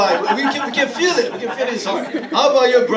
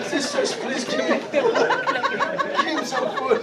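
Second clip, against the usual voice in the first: laughing; 14 semitones higher, 4 dB quieter, slower than usual. Real, not acted.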